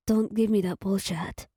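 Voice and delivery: ordinary delivery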